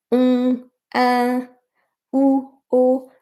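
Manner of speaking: "un, un, ou, o" as separate, held French vowel sounds; isolated French vowels in "un, un, ou, o", each sustained evenly; French vowel demo. Shown as a demonstration. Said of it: "don't pronounce the sound".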